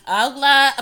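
ordinary talk, nothing marked

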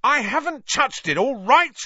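very high, loud roar back. A narrator's reading. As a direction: bellow in defence. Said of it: "Ron roared back".

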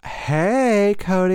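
high, loud mock greeting; put-on different voice. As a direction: imitate an overdone sing-out hello. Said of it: "That was a different voice for me".

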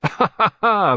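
hearty delighted ha ha ha; amused laughter. A narrator's reading. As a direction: laugh out loud, vastly entertained. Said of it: "He was vastly entertained".

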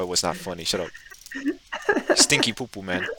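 normal talking voice

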